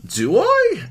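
exaggerated squeal upward on "I". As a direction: punch "I" as a very high question. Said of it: "Emphasis, higher pitch in last word".

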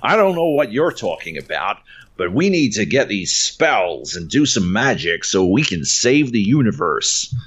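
mock American accent, badly exaggerated; Benedict Cumberbatch. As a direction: do a hammed-up bad American accent. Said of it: "get me into his accent".

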